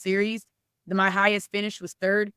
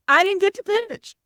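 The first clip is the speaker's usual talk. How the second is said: high, louder tearful quote of a heartbroken pitcher; imitating a crying girl; 12 semitones higher, 5 dB louder than usual. Coach retells the crying pitcher's answer, high and tearful. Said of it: "And she's just hysterical".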